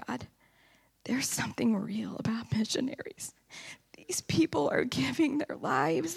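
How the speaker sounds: high, barely voiced, choked up; tearful whisper. Real, not acted. Telling the church how missionaries moved her, tearful whisper. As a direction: whisper it like the tears are close.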